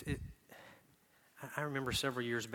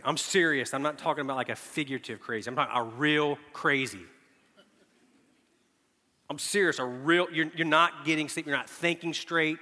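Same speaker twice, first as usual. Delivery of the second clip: louder, higher insistence; emphatic; 5 semitones higher, 9 dB louder than usual. Real, not acted. Preacher insisting sleeplessness makes you crazy, loud. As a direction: drive the point home hard.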